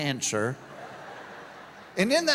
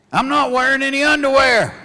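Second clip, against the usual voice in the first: higher, louder, laughing through it; man's version, laughing; 6 semitones higher, 10 dB louder than usual. Retold or imitated. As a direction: blurt it out with a laugh. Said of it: "if a man says to a woman".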